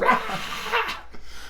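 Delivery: high-pitched mock creature screech. Host imitating the flapped creature from that scene, high screech. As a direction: imitate a screeching movie monster. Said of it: "She just goes".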